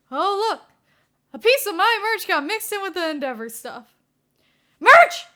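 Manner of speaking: high, loud, bursting with glee; a kid who just spotted his treasure; excited. Acted. Izuku crowing about his merch, loud and high.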